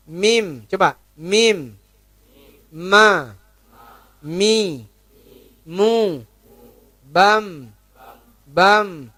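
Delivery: "Mim", "Ma" ringan di bibir; light mim demo. Shown as a demonstration. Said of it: "Mimnya ringan aja". ("Mim" diucapkan ringan)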